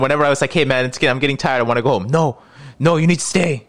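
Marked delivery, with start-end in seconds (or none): 0.5-2.1 higher-pitched mock plea to leave; lightly acted excuse to a pushy pal; retold weary excuse. 2.1-3.6 higher-pitched pushy pleading; mock-insistent, pitched well up; retold insisting. Retold man insisting he stay.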